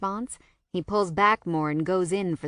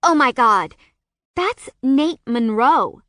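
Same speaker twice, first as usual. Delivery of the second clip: loud, high squeal of delight; squealing excitement; 5 semitones higher, 9 dB louder, slower than usual. A narrator's reading. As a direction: gush like a starstruck friend. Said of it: "She squeals".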